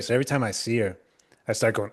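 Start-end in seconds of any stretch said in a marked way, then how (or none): none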